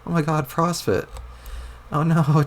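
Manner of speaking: ordinary talk, nothing marked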